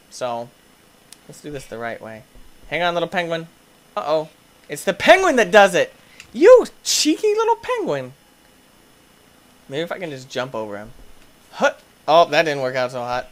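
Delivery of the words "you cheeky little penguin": mock scolding. Real, not acted. Scolding the penguin in game.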